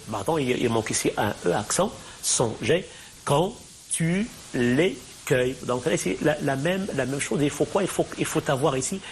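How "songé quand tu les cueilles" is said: "Songé quand tu les cueilles" slow, each syllable set apart; divided into syllables. Shown as a demonstration. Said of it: "on divise les vers ici en syllabes".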